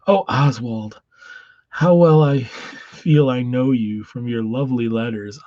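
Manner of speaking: a smitten pen pal gushing; Davina. Acted.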